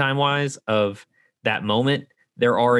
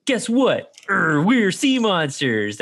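put-on excited kid reveal; 9 semitones higher, much slower than usual. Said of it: "he's like".

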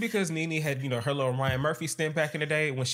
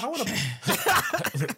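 ordinary talk

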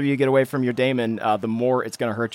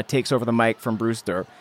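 usual speaking voice

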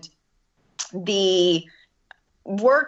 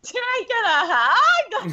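much higher, slow and louder disbelieving question; incredulous self-quote; 5 dB louder, slower than usual. Retold or imitated. She asks the girls for a hug, high slow disbelief. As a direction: ask it high and stretched, half laughing in disbelief. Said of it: "And I'm like".

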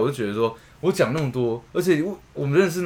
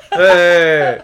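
normal talking voice

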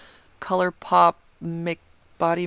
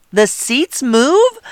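surprised; 9 semitones higher, 5 dB louder, much slower than usual. Retold or imitated. Hearing seats move in theater.

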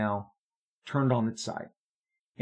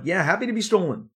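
cheeky; 8 semitones higher, 5 dB louder, faster than usual. Retold or imitated. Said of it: "I'm kinda like".

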